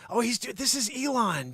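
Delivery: ordinary delivery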